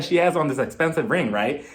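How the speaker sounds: usual speaking voice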